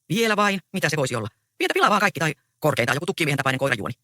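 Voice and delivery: usual speaking voice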